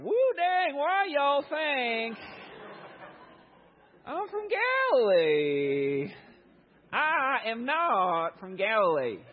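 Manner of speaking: mock Southern denial, stretched way out; retelling Peter in a hammed-up Southern accent. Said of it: "Galilee was kinda like the redneck portion".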